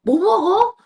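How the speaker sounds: put-on high, loud teasing voice to a toddler; mock-curious coo to a child; playful teasing adult. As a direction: tease the thumb-sucking child playfully. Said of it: "막 내가 이랬거든".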